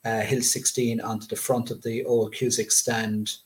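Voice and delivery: ordinary talk, nothing marked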